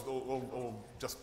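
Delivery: normal talking voice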